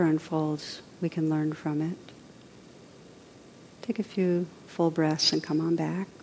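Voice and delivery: soft, unhurried meditation close; quiet meditation guide's voice; guided meditation. Acted. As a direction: ease listeners gently out of meditation.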